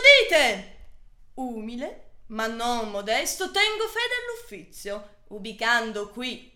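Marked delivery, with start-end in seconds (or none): 0.0-0.9 loud falling proclamation call; town crier. Acted.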